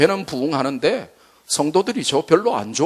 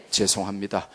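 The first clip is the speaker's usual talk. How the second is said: ordinary delivery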